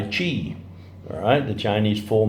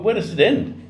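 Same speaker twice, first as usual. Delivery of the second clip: where does it end pitched up high, ending on a rise; rising exasperated question; 11 semitones higher than usual. Shown as a demonstration. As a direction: throw the question up high in disbelief. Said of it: "And where does it end?".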